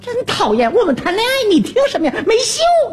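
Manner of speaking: put-on high, huffy young woman's voice; annoyed young woman. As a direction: snap at him like an annoyed girlfriend. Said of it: "女同志说话了".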